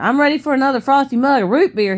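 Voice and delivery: put-on eager boy. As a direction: burst in with cheerful, high-pitched enthusiasm. Eager boy returns mid-conversation.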